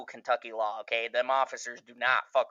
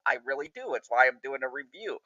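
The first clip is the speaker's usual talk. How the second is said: ordinary talk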